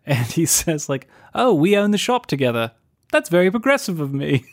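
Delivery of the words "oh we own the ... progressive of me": lightly voiced, pleasantly surprised shopkeeper; Giles. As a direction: imitate him marvelling a little at himself. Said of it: "kinda surprised that he would share ownership".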